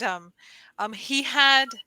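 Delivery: ordinary delivery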